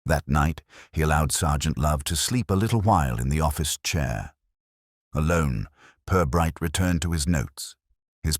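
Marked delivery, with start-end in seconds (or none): none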